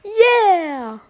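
put-on high, loud kid cheer; Leon voice line. Copying Leon's cheer for viewers, high and loud.

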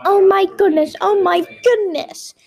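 acted worried outcry; fretful alarm. A narrator's reading. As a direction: exclaim in a little-girl panic. Tessie frets over the fight.

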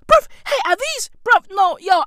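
high squeaky outraged disbelief; shrieking the fuck, stunned; shocked disbelief. Real, not acted. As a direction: pitch way up in total disbelief. Incredulous outburst.

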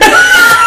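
very high, loud burst of laughter; shrieking laugh. Real, not acted. Big laugh.

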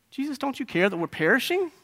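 high, pleading reproach; accusatory. Acted. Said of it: "in a very accusatory way".

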